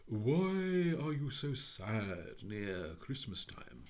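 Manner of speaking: slow, solemn bird's question; phoenix voice. A narrator's reading. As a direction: ask it slowly, like a grave old bird. Phoenix asks the lady.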